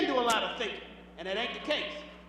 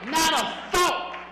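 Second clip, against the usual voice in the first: "Not a thought" drawn out slowly and loudly; emphatic slow punch; 3 semitones higher, 7 dB louder, much slower than usual. Acted. Comic on men's empty heads, slow emphatic "Not a thought". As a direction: stretch "Not a thought", hitting "thought" hard.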